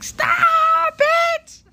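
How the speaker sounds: high squealing shout of glee; squealing stop it. Retold or imitated. Flattered by a friend, he squeals back loud. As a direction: shriek it out, flustered and delighted. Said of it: "And I'm like".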